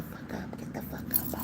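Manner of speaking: whispered harsh order, retold under the breath; soft retold curse from a father to his son; hushed quote. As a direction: hiss the dad's command quietly, as if not to be overheard. Retelling dad's harsh order.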